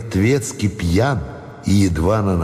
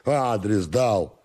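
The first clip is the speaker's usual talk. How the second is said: slow tipsy repetition, slightly soft; drunken servant; 5 semitones higher, 3 dB quieter, slower than usual. Acted. Drunken servant repeats his answer, slow tipsy drawl.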